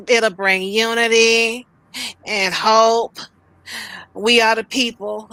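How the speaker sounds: slow, lightly mocked political speech; measured mock oration; mock speechmaking. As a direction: quote the speech grandly, with pauses between phrases. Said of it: "And then your speech".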